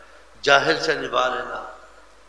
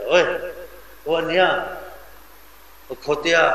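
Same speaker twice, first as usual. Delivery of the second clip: yelled higher and louder, a rough call; insulting shout; 4 semitones higher, 4 dB louder than usual. Retold or imitated. Scolding a brother harshly in imitation, insulting shout. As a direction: bark the insult across the room.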